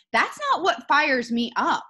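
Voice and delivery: ordinary delivery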